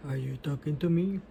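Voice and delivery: soft, low, quick mock huff; badly faked huffy murmur. Shown as a demonstration. Mother trying out a huffy voice for child, huffy. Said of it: "what's a huffy voice?".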